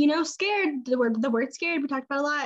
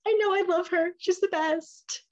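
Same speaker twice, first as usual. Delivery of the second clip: gushing; 8 semitones higher than usual. Retold or imitated. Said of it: "And I was like".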